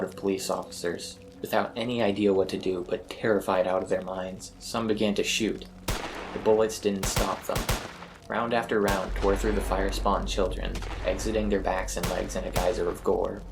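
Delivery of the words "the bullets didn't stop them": ordinary delivery, nothing marked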